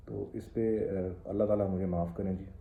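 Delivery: ordinary talk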